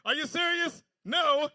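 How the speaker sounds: crowd callback. Shown as a demonstration. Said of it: "Oh, I remember now".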